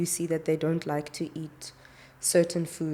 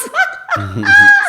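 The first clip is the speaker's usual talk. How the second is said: high loud laugh; burst of laughter; 17 dB louder than usual. Real, not acted. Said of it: "Sorry, I shouldn't be laughing".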